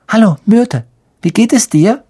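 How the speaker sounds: put-on high, overly friendly girl's voice; sweet greeting.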